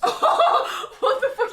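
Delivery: high, loud, slow, laughing disbelief; real laughing disbelief; shocked laugh. Real, not acted. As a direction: stretch it out in gleeful shock. Reacting to drunk MP story.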